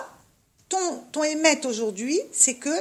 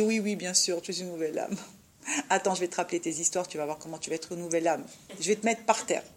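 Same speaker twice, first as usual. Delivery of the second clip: quieter, sly and sneering, a little laugh; mocking tempter; 5 semitones lower, 6 dB quieter, faster than usual. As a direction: taunt her softly, promising to drag her down. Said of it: "il dit". (tentateur moqueur, plus bas et sournois)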